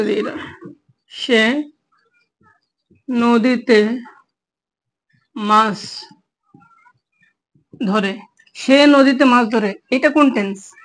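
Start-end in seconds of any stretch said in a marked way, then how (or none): 1.1-8.2 each word alone with long pauses; word-by-word dictation. Shown as a demonstration. Teacher dictating a Bangla example sentence to students, word by word. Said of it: "teacher dictates example word by word". 8.5-9.7 the sentence said through in one go, a bit slow; whole sentence. Shown as a demonstration. Teacher reading the full example sentence to students, steady and slow. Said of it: "teacher repeats example whole".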